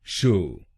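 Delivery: शो said as a clear long "sho"; first syllable alone. Shown as a demonstration. Teacher isolates the first syllable, clear sho. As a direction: give शो on its own, cleanly. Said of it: "बोलेंगे शो".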